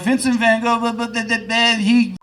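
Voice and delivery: high-pitched mocking rant; berating dad. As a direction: imitate a dad's scolding, trailing into blah-blah. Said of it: "berates her".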